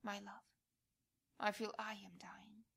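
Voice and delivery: normal talking voice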